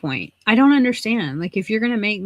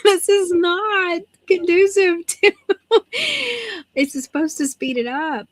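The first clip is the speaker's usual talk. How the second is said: exasperated protest; 10 semitones higher than usual. Retold or imitated. Patient protesting the repeated check-in, comically exasperated. Mock complaint breaking into laughter.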